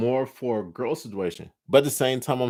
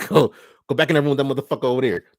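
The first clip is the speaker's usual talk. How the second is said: fast, louder furious order; angry rant; 4 semitones higher, 6 dB louder, much faster than usual. Acted. Telling the other guy to go back to the room, fast angry yell. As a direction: chase him back to that room.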